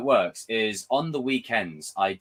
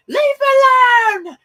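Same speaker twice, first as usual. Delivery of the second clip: high, loud, drawn-out scream; shout; 13 dB louder, slower than usual. Retold or imitated. Allison screaming at Michael to stop, shrill mock shout. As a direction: yell it out as Allison. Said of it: "Allison goes".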